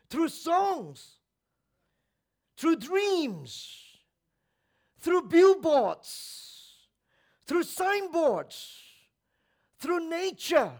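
high, loud list with pauses between items; ringing pulpit cry, each phrase falling away; impassioned preaching. Real, not acted. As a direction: call each item out to the congregation.

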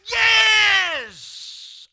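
sudden, very loud high yell of triumph; loud shout. Retold or imitated. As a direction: bellow it like a fan at a goal. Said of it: "I will shout loud".

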